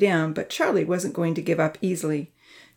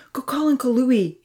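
higher-pitched urgent instruction; ordering; 7 semitones higher than usual. A narrator's reading. Amy sends Georgia for Uncle Louie, ordering. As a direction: send her off for help, urgently. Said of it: "Amy ordered Georgia".

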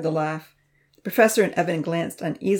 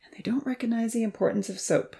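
breathy sighing whisper, a bit higher; 6 semitones higher than usual. A narrator's reading. Professor to Evan about the boys, sighing whisper. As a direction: sigh it out under your breath. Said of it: "he sighed".

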